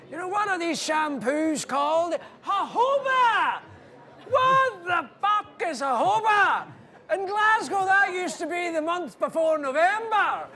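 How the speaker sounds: high, loud shouted Glaswegian comic rant; Billy Connolly impression. Comedian telling a crowd about jojoba, shouted Scottish rant. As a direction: belt it out like Billy Connolly ranting about shampoo. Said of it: "He's going".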